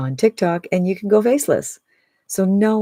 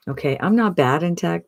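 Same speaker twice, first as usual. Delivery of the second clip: usual speaking voice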